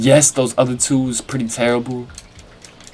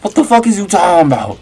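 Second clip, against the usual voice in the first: higher, louder, fast furious question; angry disbelief; 7 semitones higher, 4 dB louder, faster than usual. Retold or imitated. Girlfriend confronting him about the fake confession, angry. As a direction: demand an answer, outraged.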